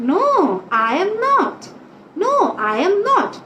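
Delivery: firm answer, "I am" lifted, "not" dropping; answer intonation. Shown as a demonstration. Teacher models the answer for pupils, rise-then-fall answering tune. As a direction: raise the middle and let "not" fall away. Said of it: "আবার যখন answer দিয়েছি".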